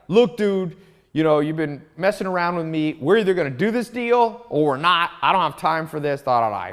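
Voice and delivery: imitating a colleague finally yelling at a client; retold yelling. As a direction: lay into the stubborn client over the phone. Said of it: "just yelling at the guy".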